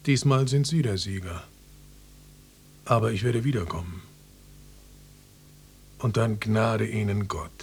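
low, measured villain's farewell; ominous threat. Acted. Defeated salesman vowing to return, ominous. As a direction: promise revenge, slowing on the last words.

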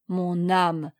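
low-key, mildly grumbled exclamation; muttered grumble. A narrator's reading. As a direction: grumble it to yourself. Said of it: "grommela maître Luc".

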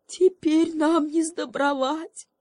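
high, slow whiny donkey; donkey whimpering. Acted. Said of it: "захныкал осёл". (высокое медленное хныканье осла)

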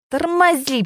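high, sudden cry to brake; alarmed instructor cries out to brake; panicked shout. A narrator's reading. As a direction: yell it as the car veers. Panicked order in swerving car.

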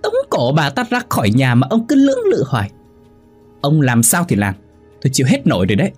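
high-pitched wifely tirade; acted hands-on-hips shrieking; furious screech. A narrator's reading. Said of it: "Mụ Hoa đứng chống nạnh rít lên".

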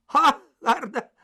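mocking the clerk's suggestion with a brazen laugh; cheeky laugh. A narrator's reading. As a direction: laugh it in his face. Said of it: "risposi sfacciatamente".

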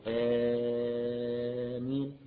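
آمين with the first alif held very long and sung for six counts. Shown as a demonstration. Teacher showing the longest alif in آمين, chanted. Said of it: "ممكن يقول".

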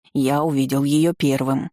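ordinary delivery, nothing marked

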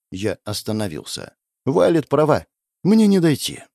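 ordinary delivery

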